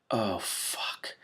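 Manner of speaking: breathy whispered swear under the breath; whispered curse. Real, not acted. Reader whispering a curse at the text. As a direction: curse softly to yourself.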